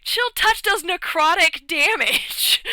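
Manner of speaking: slow, high, loud mock outcry; exasperated objection. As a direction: protest as if it's painfully obvious. Everyone objects to his absurd claim.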